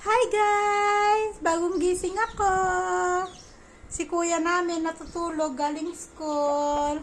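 put-on high, babyish voice as if the baby is talking; high babyish vlog greeting; pretend baby voice. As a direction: speak for the sleepy toddler. Speaking as the just-woken baby.

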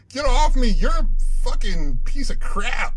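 loud furious shout at the monster; angry yell. Real, not acted. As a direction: yell it as something grabs you. Attacked in game, yelling.

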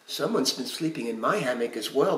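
usual speaking voice

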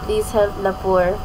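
soft, low play-begging; subdued beggar. Pretend. Repeats the begging plea subdued.

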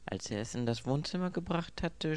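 ordinary delivery

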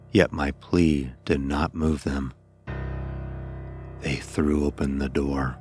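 usual speaking voice

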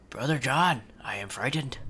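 whispered, mock frightened; scared whisper. Brother Stephen whispering to Brother John, mock frightened. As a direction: whisper your fear to your brother.

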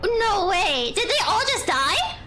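loud, laughing no way; laughing disbelief. Real, not acted. Gamer laughs as all the creatures die. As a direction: blurt it through laughter.

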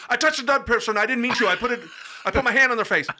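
high, loud frantic blurting; mock panicked confession. Confessing to the startling mortician, mock panicked blurt.

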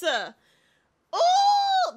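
high, loud ooh of delight. Real, not acted. Streamer thrilled by the game, delighted high ooh. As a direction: squeal with glee.

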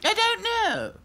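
high haughty doll voice; snooty little princess. A narrator's reading.

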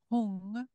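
Hung said alone with a rising tune; 1 seed syllable sounded. Shown as a demonstration. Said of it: "Hung is this last one".

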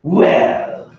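breathy, huffy put-down; haughty sniff. Acted. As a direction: sniff at him disdainfully. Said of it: "she sniffed".

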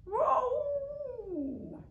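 a stuck cat yowling. A narrator's reading. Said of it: "the sound that echoed around".

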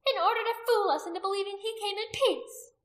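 high, loud scornful retort; disdainful. A narrator's reading. Priestess rejecting the warrior's defence of the Roman, disdainful. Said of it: "Anomia said disdainfully".

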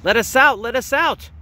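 high mock shout from the crowd. A narrator's reading. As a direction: call out high and pleading. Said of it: "They all shouted".

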